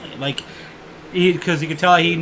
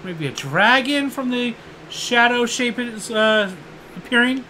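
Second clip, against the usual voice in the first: mock player guess; 7 semitones higher than usual. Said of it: "you're like".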